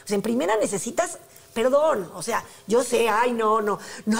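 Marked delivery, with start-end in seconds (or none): none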